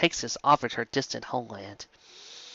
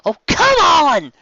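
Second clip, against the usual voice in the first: much louder and higher, drawn-out frustrated outcry; agitated cry; 11 dB louder, slower than usual. Acted. Rainbow frustrated with the dragon's refusal, loud agitated cry. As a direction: cry out in exasperation. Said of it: "Rainbow cried out in agitation".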